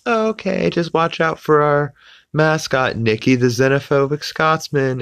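very high, chirpy saleswoman voice; upbeat sales lady. Retold or imitated. Sales lady warning browsing customers about the mascot, upbeat high voice. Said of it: "there's an upbeat sales lady".

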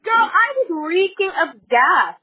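loud, slow and high-pitched; worked-up gossip to a friend; emphatic exclamation. Real, not acted. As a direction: hammer out each word in outrage. Exclaiming over her ordeal.

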